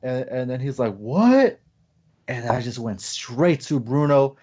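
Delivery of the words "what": high, loud bewildered what; startled what. Retold or imitated. Brother hearing the shout from inside, startled. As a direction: caught off guard, blurting it out.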